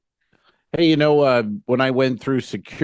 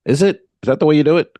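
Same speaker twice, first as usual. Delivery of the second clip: louder, higher doubting questions; retold skeptical challenge; 4 semitones higher, 6 dB louder, faster than usual. Entertainer disputing the officer's procedure, skeptical and challenging. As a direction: throw the rule back as a pointed question.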